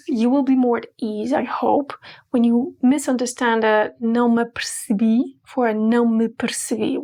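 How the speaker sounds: ordinary talk, nothing marked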